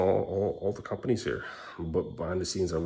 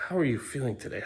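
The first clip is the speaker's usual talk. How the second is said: therapist voice; 6 semitones higher than usual. Retold or imitated. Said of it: "to listen and say".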